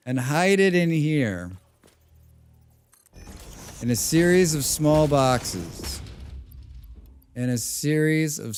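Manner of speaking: drawn-out, deliberate slow narration; slow mock drama. Streamer narrating hiding presents, slow and mock-dramatic. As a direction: stretch every word like a mock voiceover.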